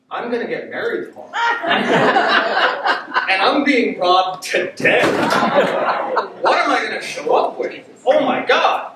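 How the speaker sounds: high, loud, laughing panic; loud, laughing panic about the wedding. Retold or imitated. Panicked inner thought, laughing.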